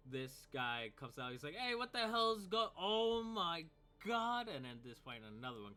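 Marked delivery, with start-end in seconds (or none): 1.6-4.5 high, loud yell that breaks off in shock; a guard stunned mid-sentence; shocked goon cut short; startled cultist. Acted. GM voices cultist stopping short.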